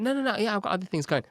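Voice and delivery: high, quick eager denial; fake reassurance. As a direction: cover the lie with enthusiasm. Lying that he has meetings.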